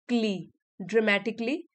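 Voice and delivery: "क्ली" alone, then "ड्रामेटिकली" ending the same; correct klee ending. Shown as a demonstration. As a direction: model "क्ली" as one syllable, then the whole "ड्रामेटिकली". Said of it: "कली नहीं बोलेंगे". (पहले "क्ली" एक साथ, फिर पूरा "ड्रामेटिकली")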